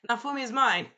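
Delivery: put-on high, loud childish claim; hammed-up possessive squeal. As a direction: stake the claim like a clingy bird girl. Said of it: "Naofumi's like".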